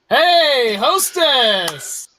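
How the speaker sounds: loud, drawn-out hail across the room; calling out. Acted. Rejected schemer hailing the hostess, calling out. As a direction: holler for service.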